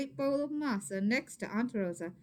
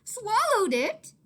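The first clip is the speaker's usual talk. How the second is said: much higher, slow, loud alarmed cry; worried cry; 13 semitones higher, 10 dB louder, slower than usual. Acted. Maria alarmed at Danny, high worried cry. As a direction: shriek it in alarm. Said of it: "cried, her eyes big with worry".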